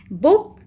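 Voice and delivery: बुक with a small, short ऊ. Shown as a demonstration. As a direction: keep the vowel in बुक brief. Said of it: "छोटा सा ऊ".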